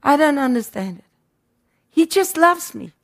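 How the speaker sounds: ordinary talk, nothing marked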